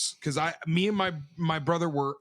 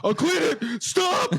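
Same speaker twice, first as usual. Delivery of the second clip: higher, rising to a whiny squeak on stop; pleading kid; 9 semitones higher, 3 dB louder, slower than usual. Retold or imitated. Kid begs grandpa with the trash can, high whiny plea. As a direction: beg like a panicked kid. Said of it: "and I'm like".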